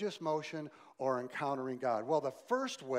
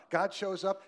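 ordinary talk

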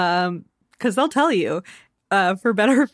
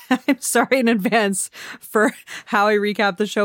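usual speaking voice

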